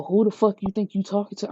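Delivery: usual speaking voice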